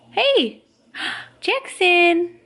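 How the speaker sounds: high sing-song call; high "hey", gasp, then the name called out. Real, not acted. Calling baby's attention from TV.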